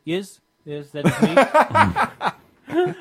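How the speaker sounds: put-on French accent growing loud and drawn-out. Pretending to be Philippe to the pizza guy, French accent. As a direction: commit to a silly French accent. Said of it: "I'm still managing the French accent".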